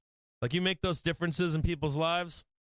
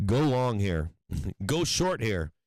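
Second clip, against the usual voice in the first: mock hedge fund advice; 7 semitones lower, slower than usual. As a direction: bark the tip like a pushy trader. Hedge fund guys giving advice.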